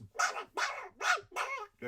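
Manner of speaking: high garbled duck squawk; high duck babble; Donald Duck quack. Retold or imitated. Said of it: "And Donald just".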